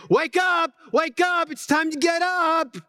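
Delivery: high, hollered wake-up call; hollering wake-up call. Retold or imitated. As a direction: holler them out of bed like a parent. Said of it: "I'm hollering".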